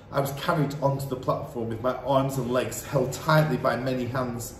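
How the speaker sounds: ordinary delivery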